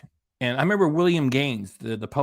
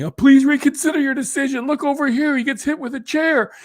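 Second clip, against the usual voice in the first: much higher, louder whiny fan voice; mock pleading fan; 13 semitones higher, 6 dB louder than usual. Vlogger mocking fans writing to the WWE, high whiny plea. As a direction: beg like a gullible wrestling fan.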